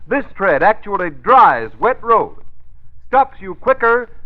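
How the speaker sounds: usual speaking voice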